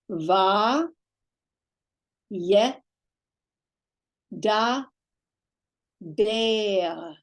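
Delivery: one syllable at a time, clearly separated: "va, ye, da, ber". Shown as a demonstration. Teacher leading students through syllables, "va, ye, da, ber" drawn apart. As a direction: sound out "va, ye, da, ber" with long pauses between syllables. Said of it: "let's put these together into syllables".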